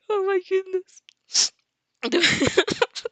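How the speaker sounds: high giggling fit; cracking up. Real, not acted. Narrator losing it over the heroine's question, high giggles. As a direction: laugh helplessly at the silliness.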